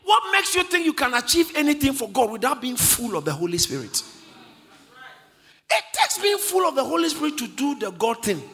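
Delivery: impassioned, rhythmic challenge to the congregation; forceful preaching. Acted. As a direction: start each question high and let it fall.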